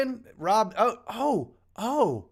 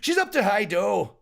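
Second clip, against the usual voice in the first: Scottish accent; 4 semitones higher, 5 dB louder than usual. Shown as a demonstration. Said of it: "I don't know if I'm doing that right".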